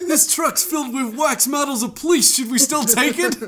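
puzzled Jersey thug through giggles; New Jersey accent. Acted. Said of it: "is like".

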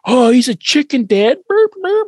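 put-on high, loud taunting kid voice; high sneering schoolyard taunt; mocking bully kid. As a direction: jeer like a bratty bully.

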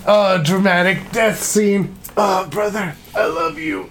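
hammy overwrought death scene; mocking dramatic death. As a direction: overact a tearful farewell. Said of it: "where it's like".

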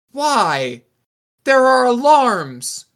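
high, slow, loud wail; loud wailing alarm. Acted. Stone sets off the alarm, wailing. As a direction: cry out in drawn-out blasts like an alarm.

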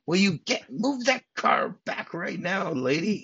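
imitated irate cop, high and insistent; screaming. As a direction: act out yelling at a driver to move.

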